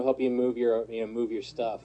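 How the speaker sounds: usual speaking voice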